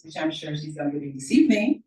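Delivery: ordinary talk, nothing marked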